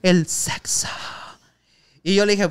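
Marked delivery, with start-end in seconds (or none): 0.0-0.9 mock seductive. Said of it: "Así me decía, güey".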